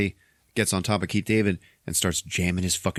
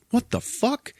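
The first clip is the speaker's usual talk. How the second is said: incredulous; slower than usual. Retold or imitated. Keith David reacts.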